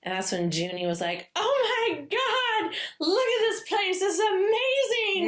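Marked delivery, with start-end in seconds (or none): 1.3-5.3 put-on much higher, slow, gushing like an excited kid; wide-eyed delighted gushing; childlike excitement. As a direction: squeal it like a child in a new house. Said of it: "like a friggin' kid".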